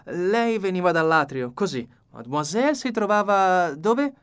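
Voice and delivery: the meticulous detective demanding order; Poirot, insistent. A narrator's reading. Poirot directs the reconstruction.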